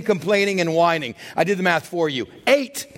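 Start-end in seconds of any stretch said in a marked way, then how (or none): none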